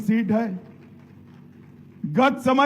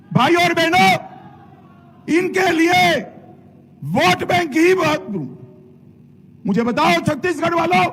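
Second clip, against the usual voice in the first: booming political rally speech; 6 semitones higher than usual. Real, not acted. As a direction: rouse the crowd from the stage. Rally crowd address.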